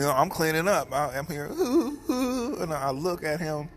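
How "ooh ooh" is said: mock whimper, much higher. Brother whimpering over a sad movie, high mock whimper. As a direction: imitate a brother's little whimpers.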